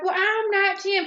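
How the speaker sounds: mock high-pitched retort; high mocking protest. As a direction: voice jumps way up, indignant. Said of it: "She's like".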